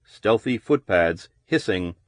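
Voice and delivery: usual speaking voice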